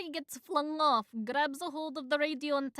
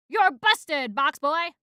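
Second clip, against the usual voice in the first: loud gotcha yell; loud, gleeful catching-you shout; triumphant shout; 4 semitones higher, 11 dB louder, slower than usual. Acted. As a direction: bust him loudly. Catching the intruder.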